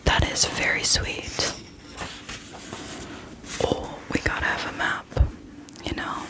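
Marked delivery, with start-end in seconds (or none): none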